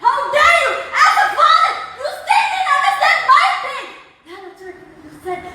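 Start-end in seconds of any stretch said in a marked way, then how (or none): 0.0-3.8 raised, higher, slow accusing voice; angry outburst. Real, not acted. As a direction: hurl it at him as a wounded daughter. Daughter confronting father over her pain.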